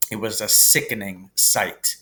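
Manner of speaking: ordinary delivery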